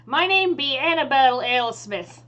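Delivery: loud, proud pirate introduction; pirate captain. Acted. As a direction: announce yourself as a pirate captain. GM voices pirate captain introducing herself.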